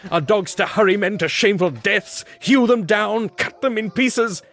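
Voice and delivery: very high, loud, rising hysteria; frantic outcry. A narrator's reading. Guilty man protesting as the dogs howl, hysterical. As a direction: rant wildly, slowing on the last words. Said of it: "I cried".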